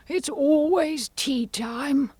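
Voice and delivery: high, drawn-out Hatter lament; Mad Hatter, resigned. Acted. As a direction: play the Hatter sighing over his lot, pitched high.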